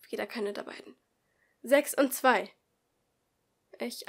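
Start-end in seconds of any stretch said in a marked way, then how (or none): 1.6-2.5 loud, higher announcement; calling out. Acted. Teacher reads out the group numbers, loud. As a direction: read the pair out to the room.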